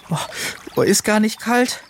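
high, shivering voice; chattering with cold. A narrator's reading. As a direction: chatter it out while pretending to be fine. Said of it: "Und er schnatterte". (hoch und vor Kälte schnatternd)

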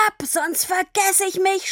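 high, furious threat; shouting. A narrator's reading. Papa sends Tini away, shouting. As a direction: order them off with a strained shout. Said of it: "schrie er".

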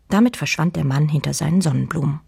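plain reading voice

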